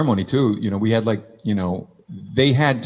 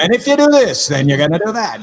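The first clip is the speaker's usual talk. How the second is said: much higher, louder preachy mock voice; mocking priest; 8 semitones higher, 5 dB louder than usual. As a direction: lampoon a bossy priest laying down rules.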